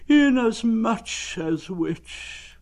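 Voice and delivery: very slow, high, drawn-out mournful donkey; gloomy Eeyore. Acted. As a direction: drag it out like a sad old donkey.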